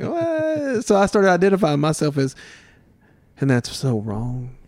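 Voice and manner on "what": much higher, startled mock what; baffled what. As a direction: play it as total disbelief. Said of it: "And I'm like".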